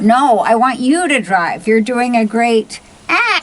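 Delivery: loud sarcastic stress on you; angry sarcasm. Acted. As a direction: throw the line back, furious. Acted quarrel.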